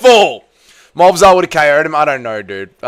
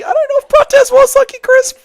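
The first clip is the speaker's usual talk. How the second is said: much higher, squeaky mocking voice; 3 dB louder than usual. Real, not acted. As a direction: answer in a silly squeak. Answers chatter in odd voice.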